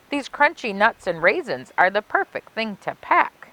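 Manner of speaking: put-on lilting rhymed read-aloud for children; storybook narration. A narrator's reading. Librarian reading a Halloween rhyme to kids, storybook lilt. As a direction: savor each rhyme like a spooky bedtime tale.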